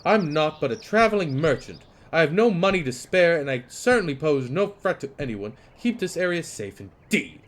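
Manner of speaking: loud, high protesting merchant; indignant merchant. Acted. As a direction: insist on your innocence. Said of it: "emphasizing words".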